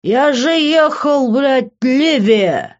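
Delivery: put-on slow, loud, high drunken wail; whining drunk plea; drunken protest. A narrator's reading. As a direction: drag it out in slurred indignation. Drunk man protests to girlfriend.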